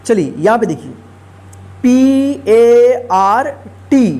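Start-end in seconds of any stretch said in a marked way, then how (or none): 1.8-4.1 letters spelled out slowly one by one; slow spelling. Shown as a demonstration. Teacher spells word letter by letter.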